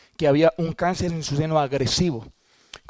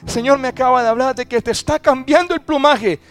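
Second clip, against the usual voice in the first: high, loud acted hallelujah cry, stretched out; triumphant calling out to wife; jubilant shout; 9 semitones higher, 8 dB louder than usual. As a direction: proclaim joyful news at full voice. Acts out his joyful announcement to wife.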